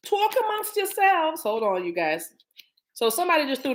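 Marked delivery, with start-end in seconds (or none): 0.1-1.4 playful high call. Real, not acted. Playful repeat.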